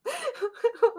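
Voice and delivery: high, crying-laugh squeal. Real, not acted. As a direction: laugh till it almost sounds like crying. Laughing at show's malfunctions.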